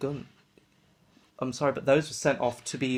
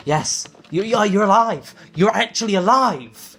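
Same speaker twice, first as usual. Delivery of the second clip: overjoyed shout; 5 semitones higher, 10 dB louder than usual. Acted. GM voices overjoyed Doc.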